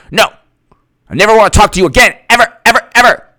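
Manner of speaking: high, quick, huffy outburst; mock tantrum. Ending a friendship, high mock tantrum. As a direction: hammer each last word high.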